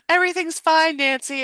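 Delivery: hammed-up dismissive call; mock calling out. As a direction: mimic hollering back across a street. Deputy calls back across street.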